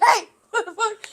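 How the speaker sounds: a surprised hey, laughing; startled guy. Retold or imitated. Crackhead surprised by the kids, laughing mock yell. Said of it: "This guy was like".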